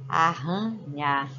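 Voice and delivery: Arranha said slowly with a hard throaty rr; slow Arranha, stressing the rr sound; strong double R. Shown as a demonstration. Teacher to pupils, showing the rr in Arranha. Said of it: "Se eu colocar mais um R".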